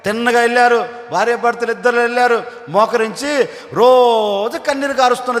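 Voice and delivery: usual speaking voice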